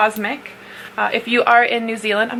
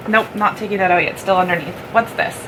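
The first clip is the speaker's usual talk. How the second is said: ordinary delivery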